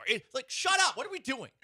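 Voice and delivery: very high, incredulous scoff; exasperated disbelief. Real, not acted. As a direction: throw up your hands in disbelief. Mocking the radio show.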